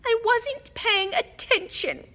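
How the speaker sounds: put-on high, slow melodramatic ghost girl; dramatic. A narrator's reading. Ghost girl answers the question, overly dramatic. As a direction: drag it out with theatrical sulk. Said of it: "said Myrtle dramatically".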